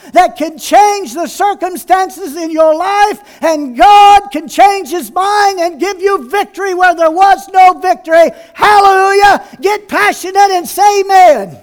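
loud, high climactic preaching; preaching at full force. Acted. Pastor rousing the congregation, loud climactic preaching. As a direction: rouse the congregation.

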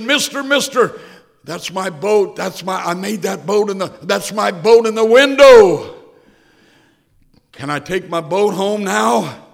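put-on high, loud, pleading little boy; excited boy. Little Tommy begging the store manager, high and loud. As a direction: beg like a thrilled child. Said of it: "He said".

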